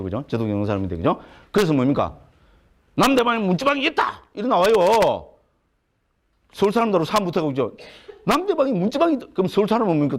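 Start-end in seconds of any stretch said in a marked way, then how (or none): 2.9-4.7 high-pitched, cocksure declaration; stubborn Gyeongsang know-it-all. Acted. Lecturer mimics an ignorant Gyeongsang man insisting, high and cocksure. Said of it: "경상도 사람들은 뭡니까? 좀 무식하죠". 8.2-9.3 high, insistent mock claim; stubborn Gyeongsang know-it-all. Lecturer repeats the Gyeongsang man's claim, high and insistent. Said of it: "경상도 사람들은 뭡니까? 좀 무식하죠".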